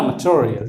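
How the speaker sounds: "मटेरियल" as muh-cho-ri-yal; short muh, ch-like T in "मटेरियल"; corrected material. Shown as a demonstration. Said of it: "ये पढ़ने का असल तरीका".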